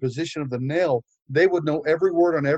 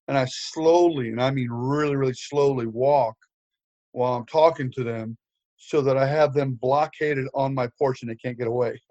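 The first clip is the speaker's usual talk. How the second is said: usual speaking voice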